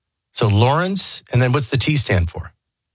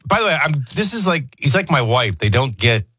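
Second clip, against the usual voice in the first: ordinary talk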